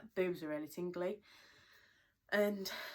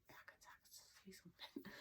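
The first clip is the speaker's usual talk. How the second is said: hushed secretive whisper; 3 semitones higher, 20 dB quieter than usual. Retold or imitated. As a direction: breathe it like a secret. Quoting her own unsure thought.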